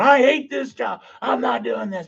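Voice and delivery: high, strained mock outburst; hamming up an old tantrum; mock angry rant. Retelling cussing at training, a hammed-up angry yell. Retold rage while throwing gear.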